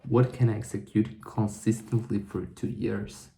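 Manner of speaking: normal talking voice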